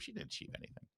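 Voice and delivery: scornful whispered aside. Real, not acted. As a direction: mutter it under your breath with contempt. Sneering aside during book reading.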